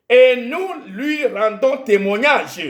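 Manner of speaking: normal talking voice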